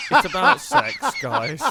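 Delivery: loud, high and laughing; loud laughing reveal to the other hosts; laughing shout. Real, not acted. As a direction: blurt it out through a laugh.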